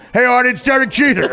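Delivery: ordinary delivery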